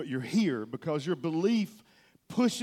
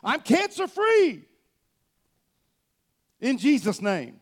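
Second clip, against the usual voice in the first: much higher, louder, slow proclaiming; joyful proclamation; 7 semitones higher, 7 dB louder, slower than usual. Retold or imitated. Pastor retells proclaiming his healing on stage, loud and joyful. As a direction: declare it in triumph. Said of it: "standing on this stage going".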